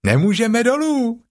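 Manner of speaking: very high little-kangaroo cry; high-pitched childlike exclamation; Roo calling out. Acted. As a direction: call down like a small child. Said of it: "zvolal klokánek".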